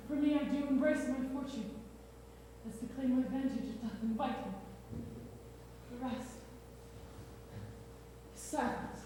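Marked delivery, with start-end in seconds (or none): 5.9-9.0 soft, breathless last words; faint deathbed whisper. Acted. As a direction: let the final word fade into a whisper.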